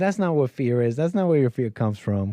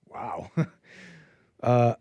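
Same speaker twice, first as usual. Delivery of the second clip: ordinary talk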